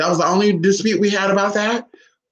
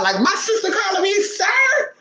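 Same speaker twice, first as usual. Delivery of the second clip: high-pitched, incredulous squeal; retold shock; 13 semitones higher than usual. Retelling the sister's call to viewers, shocked. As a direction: voice shoots way up in mock disbelief. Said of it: "So I'm shocked now".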